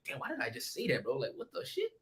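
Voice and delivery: hushed inner voice of regret. Retold or imitated. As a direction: mutter it to yourself, full of regret. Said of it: "In my head, I was talking".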